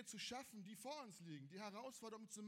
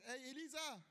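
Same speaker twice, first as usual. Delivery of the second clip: panicked servant; 7 semitones higher than usual. Retold or imitated. Servant bursting in on his master, panicked and high. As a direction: blurt it out in a panic, pitched way up. Gehasi panics running to Elisa.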